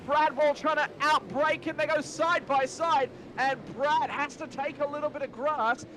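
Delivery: building excitement through the corner; motorsport commentator. Real, not acted. Live race play-by-play.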